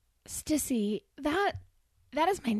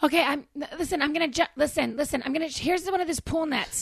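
frantic; 7 semitones higher, 7 dB louder than usual. Pretend. Frantic rescue attempt.

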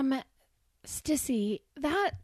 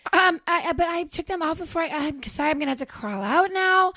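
frantic; 7 semitones higher, 7 dB louder than usual. Pretend. Frantic rescue attempt.

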